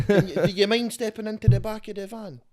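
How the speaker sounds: high, loud police put-on; mock police officer. Officer ordering a suspect into the van, mock-official. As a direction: play an overeager officer, pitched right up.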